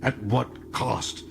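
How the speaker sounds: slow, held-back fury; slow smoldering question from a furious prisoner; repressed fury. Acted. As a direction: hold the anger down, drag it out. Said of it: "smoldered with repressed fury".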